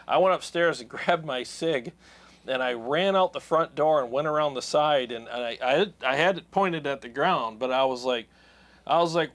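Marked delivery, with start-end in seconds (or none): none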